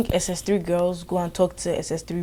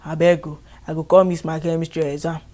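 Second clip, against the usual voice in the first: mock begging in Nigerian Pidgin, louder; pleading pidgin; 4 dB louder than usual. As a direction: plead like a scared student in Pidgin. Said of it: "everybody was like".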